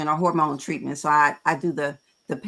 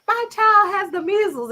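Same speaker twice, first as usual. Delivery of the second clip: mock sad coworker; 13 semitones higher, 4 dB louder than usual. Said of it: "tells a story like".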